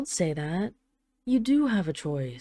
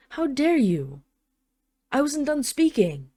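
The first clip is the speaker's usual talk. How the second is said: higher and louder, affronted royal anger; indignant outrage; 7 semitones higher, 5 dB louder than usual. Acted. Dragon queen scolds her walking-away husband, indignant and loud. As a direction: put him in his place, offended.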